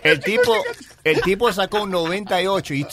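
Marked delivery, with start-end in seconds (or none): 0.0-0.6 excited. Real, not acted. Excited retelling.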